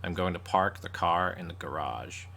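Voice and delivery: deliberate mock-American accent; imitation American accent. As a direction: mimic an American fumbling British car words. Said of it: "if an American started talking".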